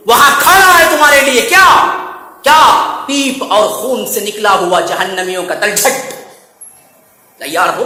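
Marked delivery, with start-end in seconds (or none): none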